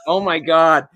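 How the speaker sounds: loud, exasperated oh my God; stunned, exclaimed reaction; retold dismay. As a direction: burst out in mock-horrified disbelief. His shocked reaction, retold.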